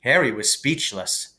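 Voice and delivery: usual speaking voice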